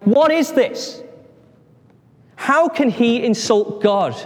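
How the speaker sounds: high-pitched indignant disbelief; acted outrage. David hearing Goliath insult God, indignant outburst. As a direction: protest as if scandalised.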